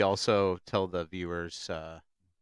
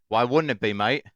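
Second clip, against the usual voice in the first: mock Australian; 4 semitones higher, 6 dB louder than usual. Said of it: "Australian accent".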